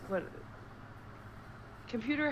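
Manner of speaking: ordinary talk, nothing marked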